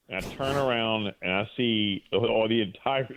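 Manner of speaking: usual speaking voice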